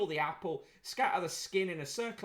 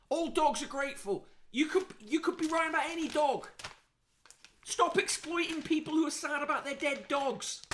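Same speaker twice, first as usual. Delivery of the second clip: indignant; 8 semitones higher than usual. Real, not acted. Outraged at the pet psychic.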